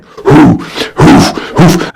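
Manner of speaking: loud breathy hoots of an approaching caveman. Pretend. Caveman brother coming up from under the museum, loud hoots. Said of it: "you'll hear him coming".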